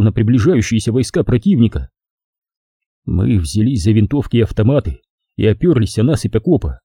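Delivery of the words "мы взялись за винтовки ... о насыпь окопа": ordinary delivery, nothing marked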